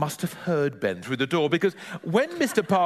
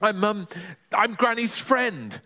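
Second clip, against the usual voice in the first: spluttering; 7 semitones higher than usual. Acted. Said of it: "spluttered Ben".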